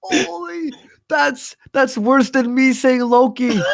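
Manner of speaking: high, laughing disbelief. Real, not acted. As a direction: gasp out the words laughing. Reacts to the co-host's gaffe.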